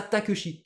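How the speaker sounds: TAKESHI as ta-keu-chi; French misreading. Retold or imitated. Teacher quoting the commentator's mistake, TAKESHI as ta-keu-chi. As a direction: flatten TAKEUCHI into three syllables, TAKESHI. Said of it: "Donc lui, il disait ça".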